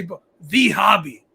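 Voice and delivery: loud, sneering mimic of people saying it; mocking quote. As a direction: parrot them loudly to mock the phrase. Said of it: "for people to just say".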